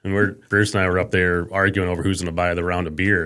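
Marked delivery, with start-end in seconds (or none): none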